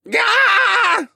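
high, loud yelp of a scream; sudden high-pitched cry. A narrator's reading. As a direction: scream as you fall. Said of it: "he screamed".

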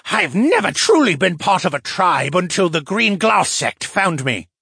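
bitter outburst, high and loud; snarling. A narrator's reading. Captive merman on life as an outcast, snarling. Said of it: "the fisherman snarled".

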